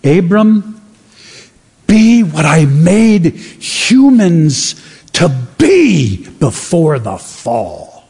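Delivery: slow, solemn, deliberate divine voice; voice of God. Retold or imitated. Preacher voicing God slowly to Abram. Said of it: "He's basically saying".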